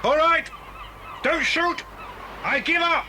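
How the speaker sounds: Bill shouting his surrender to the gunmen; calling out surrender. Acted. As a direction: call out to stop them shooting. Surrendering to armed crooks.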